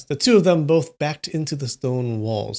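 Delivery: ordinary delivery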